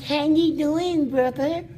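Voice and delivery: squeaky baby-talk imitation of the little inmate. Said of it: "try to talk like a baby".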